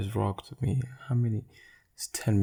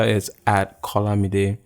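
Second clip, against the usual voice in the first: ordinary talk, nothing marked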